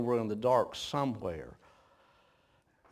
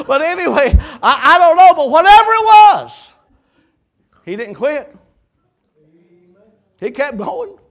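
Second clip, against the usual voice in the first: much higher and louder, driving preacher cadence; preaching at full force; 12 semitones higher, 15 dB louder than usual. Acted. Preacher rousing the congregation about battles, full-force preaching. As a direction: build it into a rousing sermon climb.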